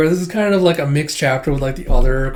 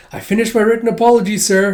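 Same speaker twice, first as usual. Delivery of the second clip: put-on high, fast, earnest little boy reporting to his teacher; eager schoolboy; 7 semitones higher, 4 dB louder than usual. Boy reporting his apology to the teacher, high and fast. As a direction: rattle it off like a keen pupil. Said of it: "he's like".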